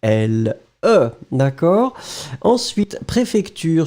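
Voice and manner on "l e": slow letter-by-letter spelling; slow spelled-out letters. Shown as a demonstration. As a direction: spell each letter out slowly. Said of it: "So quelle".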